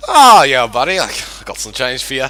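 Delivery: a loud high "Ah" in a light put-on merchant accent that fades toward his own voice; a pushy trader angling for a sale, lightly accented. Merchant pushing the adventurer to buy before giving change, lightly accented. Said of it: "I lost the accent".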